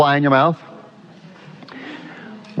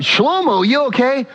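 concerned passerby; 12 semitones higher than usual. Retold or imitated. Bystander asks the retching man.

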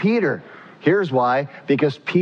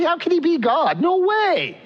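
much higher, mocking kid brother scoffing; preacher voicing James's disbelief, much higher. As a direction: sneer like a jealous sibling. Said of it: "He thought".

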